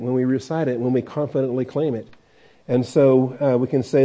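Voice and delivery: ordinary talk, nothing marked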